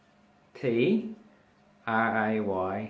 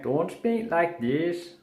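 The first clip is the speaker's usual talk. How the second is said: "Don't speak like this" slow, high and lilting; sing-songy; 7 semitones higher, slower than usual. Shown as a demonstration. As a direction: swing the pitch high through "Don't speak like this", drawn out. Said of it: "that is a sing-songy".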